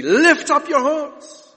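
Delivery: slow, high, loud proclamation; ringing, drawn-out summons to worship; liturgical call. Acted. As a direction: call it out to the congregation.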